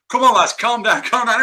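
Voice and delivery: copper calming fans. Retold or imitated. Policeman holding back brawling fans, loud calming call. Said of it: "And this copper's saying".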